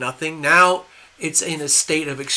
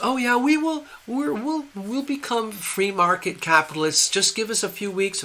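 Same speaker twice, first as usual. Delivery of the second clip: higher-pitched, glib mock leader; leader's false promises; 6 semitones higher than usual. Leader feeding Americans empty promises, mock-sincere higher voice. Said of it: "B S that's coming out of the leader's mouth".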